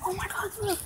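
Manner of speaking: high, soft shocked gasp; gasp of surprise. Real, not acted. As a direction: breathe it out in shock. Seeing the damage.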